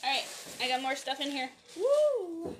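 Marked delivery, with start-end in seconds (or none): 1.8-2.5 high happy whoop; excited whoop. Real, not acted. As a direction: whoop with delight. Finds more stuff, cheering.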